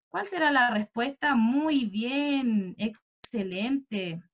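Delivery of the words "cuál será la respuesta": ordinary talk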